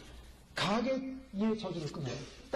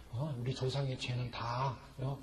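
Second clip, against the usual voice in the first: mock curse-breaking prayer; 8 semitones lower than usual. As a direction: lightly voice a deliverance pastor praying. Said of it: "이렇게 하면 된다".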